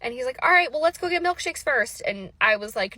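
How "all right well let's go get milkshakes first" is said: high, louder, gleeful offer of a treat; bouncy high milkshake invite; playful announcement. Retold or imitated. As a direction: sell the milkshake plan like a fun dad. Bishop proposing milkshakes.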